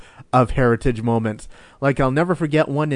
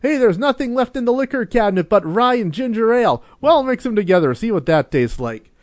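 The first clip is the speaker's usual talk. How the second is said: party guest voice; 9 semitones higher than usual. Retold or imitated. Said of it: "the whole joke, New Year's Eve party".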